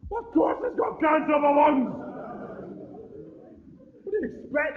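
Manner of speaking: incredulous angry shout; exasperated shout. Real, not acted. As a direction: yell it at the telly. Ranting back at TV couple.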